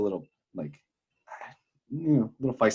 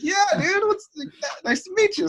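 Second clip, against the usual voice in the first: very high-pitched, loud retold greeting; excited greeting; 5 dB louder than usual. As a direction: greet a new buddy, voice shooting up.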